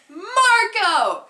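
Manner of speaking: high, loud reenacted scream; screamed call. Girl yelling from car window at drive-thru, screamed. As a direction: belt the name out as a long call. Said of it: "and then I screamed".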